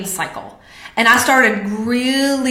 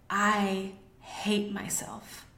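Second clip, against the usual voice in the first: very soft, slow inner voice; small inner voice; 14 dB quieter, much slower than usual. Retold or imitated. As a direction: let it come out softly and slowly from deep inside. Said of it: "the little voice that came up from somewhere deep".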